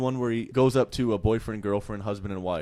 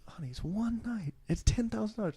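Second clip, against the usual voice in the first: coaxing husband; 11 semitones higher, 4 dB quieter than usual. Retold or imitated. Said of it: "He's like".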